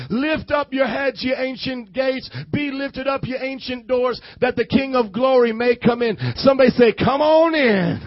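booming scripture reading; preaching. Acted. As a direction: preach it loud to the church.

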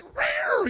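high, loud comic "rawr"; mock angry roar. Husband mimics Nancy turning on the neighbor, mock roar. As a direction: imitate her flaring up like a wildcat. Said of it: "you ever seen Nancy like that?".

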